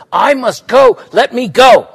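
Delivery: a loud, frantic plea; frantic cry. Acted. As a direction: beg to be released at full voice. Girl wrestled onto bench.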